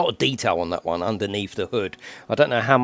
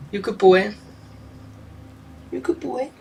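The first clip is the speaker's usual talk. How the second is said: higher cooing praise; praising pet; 13 semitones higher, 5 dB quieter than usual. Real, not acted. Owner praising her bird, high cooing. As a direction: coo praise at a good bird.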